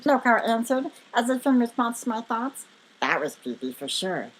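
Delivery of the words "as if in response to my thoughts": usual speaking voice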